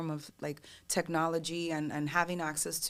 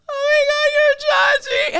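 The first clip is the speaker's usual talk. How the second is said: loud, very high mock wail, drawn out slowly; bawling fan; 18 dB louder, slower than usual. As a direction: mimic a fan bursting into overjoyed tears. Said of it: "she just starts bawling".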